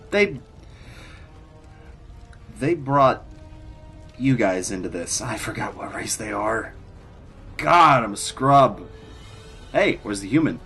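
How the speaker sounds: ordinary delivery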